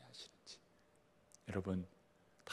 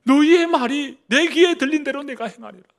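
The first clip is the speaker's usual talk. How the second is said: much higher and louder, ringing proclamation; thundering voice of God; 12 semitones higher, 13 dB louder than usual. Acted. Preacher repeating God's warning to the people, thundering. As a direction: cry it out like a divine verdict.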